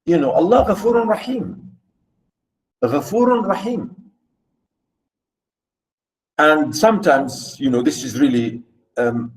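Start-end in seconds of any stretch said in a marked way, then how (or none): 0.3-3.9 "Allah Ghafurun Rahim" with a guttural gh and a rolled r; Arabic phrase. Shown as a demonstration. Teacher quoting the Arabic saying in Arabic sounds. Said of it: "we say".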